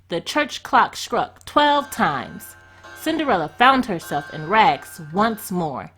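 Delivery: plain reading voice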